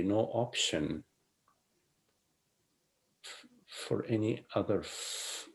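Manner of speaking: hushed, unhurried guiding voice; soft spiritual teaching. Acted.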